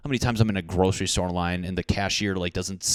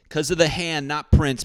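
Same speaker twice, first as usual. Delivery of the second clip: louder, higher punchline repeat; punchline said again, louder; 8 semitones higher, 7 dB louder than usual. Shown as a demonstration. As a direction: hammer the punchline home louder. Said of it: "'Cause of the hand".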